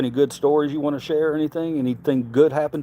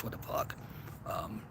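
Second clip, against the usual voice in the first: whispered disbelief; 6 semitones lower, 18 dB quieter than usual. Real, not acted. Baffled by a chat comment.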